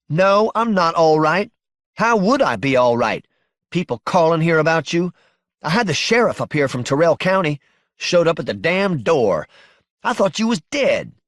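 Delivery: high-pitched, distressed reproach; upset wife. Acted. Wife reproaching husband she thought was dead, distraught.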